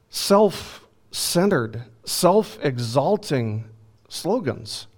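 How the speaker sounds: slow disdain. Acted. Preacher spits out each word.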